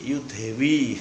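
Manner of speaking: Javanese reading of you they. Retold or imitated. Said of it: "Ini kalau orang Jogja kan bacanya gitu".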